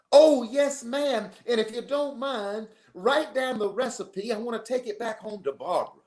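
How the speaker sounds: high-pitched, hammed-up courtly coach; Southern gentleman. Visiting coach to the mother over apple pie, Southern gentleman. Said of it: "Six-foot-two Southern gentleman".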